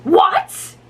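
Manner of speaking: sharp, high, loud What?; rough exclamation. A narrator's reading. Manager overhearing girls, rough loud exclamation. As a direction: bark it out in alarm. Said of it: "he exclaimed roughly".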